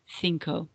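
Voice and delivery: "Cinco" begun with a soft th, "thinko"; Castilian cinco. Shown as a demonstration. Teacher repeats "Cinco" slowly for the class with a th. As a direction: clear th at the start of "Cinco". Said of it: "my Spanish is from Spain".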